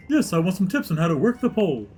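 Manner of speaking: high-pitched, hammy dad on the phone; put-on dad voice. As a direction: greet in a silly high dad voice. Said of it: "I better call my daughter".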